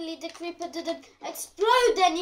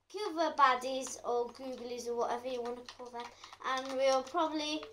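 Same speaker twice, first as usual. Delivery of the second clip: ordinary delivery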